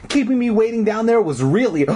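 high-pitched irritated complaint; snapping. A narrator's reading. Girl scolds Zeke for leaving her waiting, snapping. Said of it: "I snapped".

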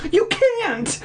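very high mock scream; screamed objection. Boy objecting to dad's pound threat, screaming. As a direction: squeal it in acted outrage. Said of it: "I screamed".

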